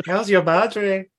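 slow, high mock Spanish accent. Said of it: "with a Spanish accent".

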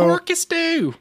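squeaky high-pitched echo; silly high voice. Pretend. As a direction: say it in a goofy high voice. Echoing in silly voice.

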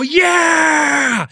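big high triumphant yell; power shout. Retold or imitated. Trainee leaping up from the chair: a big high triumphant yell. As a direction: roar it out as you jump up. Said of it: "you go".

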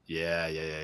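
normal talking voice

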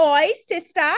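high-pitched mock Cockney British; exaggerated fake British greeting; Alex Drake. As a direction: ham up a bad British accent. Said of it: "It's very Alex Drake".

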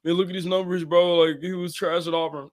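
a hint of a sneering hater; mocking doubters. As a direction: scoff like a know-it-all fan. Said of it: "but a lot of people don't".

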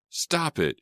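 high frantic shriek. A narrator's reading. Sydney shrieking at the two fighters, frantic. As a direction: shriek to make them stop. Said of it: "she shrieked".

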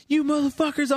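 high-pitched panicked exclamation at normal volume; retold freak-out. Friend retelling seeing blood on the sheets, high-pitched freak-out. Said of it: "I freak out".

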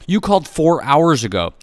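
loud, high-pitched angry retort; angry yell. A narrator's reading. Wife answers husband in the bedroom, yelling angrily. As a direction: fire it back at a yell. Wife yells back in quarrel.

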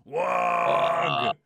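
loud orc battle shout; orc war cry. Shown as a demonstration. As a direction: bellow it like a charging orc. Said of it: "they go".

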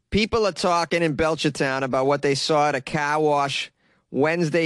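put-on news story read in Boston accent; put-on Boston local reporter. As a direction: read the article like a Bostonian, dropping the R's. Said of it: "exercise my friggin' accent".

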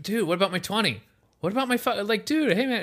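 high, fast mock protest; imitating upset kid. Kid pleads with David Blaine, high mock protest. Kid losing his twenty.

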